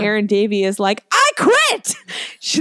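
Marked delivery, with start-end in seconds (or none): 1.1-1.9 high, loud shriek of quitting; mock scream. Actress quitting the troupe onstage, mock scream. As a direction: squeal it like storming off stage. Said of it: "like, screams".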